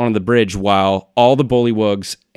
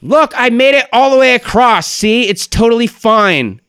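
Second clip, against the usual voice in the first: high, loud call from far across; distant echoing call; 14 semitones higher, 6 dB louder than usual. Acted. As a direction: holler back over a long bridge. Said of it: "you hear a voice echo".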